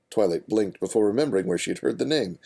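ordinary delivery, nothing marked

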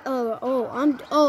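ordinary delivery

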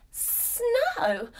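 high voice with a long hissed S; hissing snake. A narrator's reading. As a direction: hiss the start like a friendly snake. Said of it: "and she hisses".

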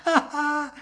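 loud, very high "ha ha" laugh; mocking laugh. A narrator's reading. Carved mouth defying its maker, mocking laugh. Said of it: "lachte der Mund".

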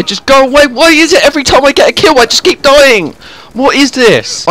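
high-pitched frustrated whine; real wailing exasperation; exasperated outburst. Real, not acted. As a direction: let the pitch jump up and complain in disbelief. Keeps dying, loses patience in game.

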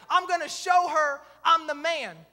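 loud, slow, emphatic boast; burst of bravado. Retold or imitated. Husband out to impress his wife, loud slow bravado. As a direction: declare it slowly and forcefully. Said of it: "I had this sudden burst of faith".

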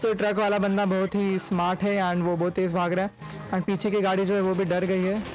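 normal talking voice

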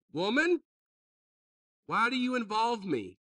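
high, loud challenge on Woman; brusque retort. Acted. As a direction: snap it as a sharp question. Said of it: "not to speak like this".

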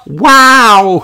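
high, loud amazed wow; gasped wow. Acted. Peppa sees tall rainforest trees, loud amazed wow. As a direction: gasp it out in awe. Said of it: "gasped Peppa".

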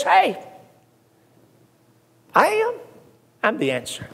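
high, gentle call from Jesus, then a quiet close; Jesus speaking. Acted. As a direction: voice Jesus stepping out of the book to answer. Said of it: "and Jesus says".

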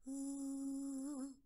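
high falsetto tone held. Shown as a demonstration. Coach showing how to bring the falsetto down, high falsetto note. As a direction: hold a light falsetto note. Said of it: "Bring the falsetto as far down as you can".

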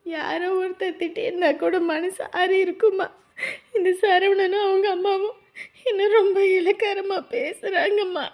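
tearful complaint, sobs between phrases; crying. Acted. Daughter telling her mother she was belittled, crying. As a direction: pour out the hurt through tears.

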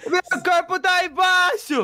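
drawn-out, slow exclamation of alarm; alarmed cry. Pretend. Player cries out that their body is stuck below, alarmed. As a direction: stretch it out in mock horror.

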